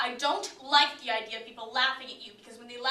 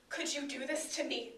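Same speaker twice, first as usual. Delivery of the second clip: normal talking voice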